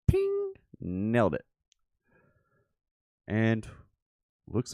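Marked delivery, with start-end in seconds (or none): 0.1-0.5 a high ping, like hitting a target; ping it out high, like a bell; mouth-made ping sound; high ping sound effect. Real, not acted.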